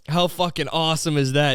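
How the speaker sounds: ordinary delivery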